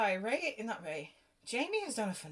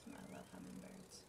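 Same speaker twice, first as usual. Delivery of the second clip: soft fond whisper; 13 dB quieter than usual. Real, not acted. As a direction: whisper it tenderly.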